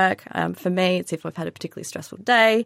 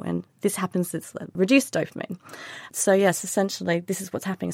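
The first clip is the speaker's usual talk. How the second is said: ordinary talk, nothing marked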